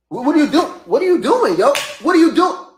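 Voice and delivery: very high, loud, frantic protest; acting out begging an attacker to explain; panicked high-pitched shouting; distressed yelling. Acting out protest at being hit.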